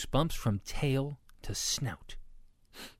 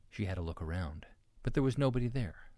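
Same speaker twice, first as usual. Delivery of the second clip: usual speaking voice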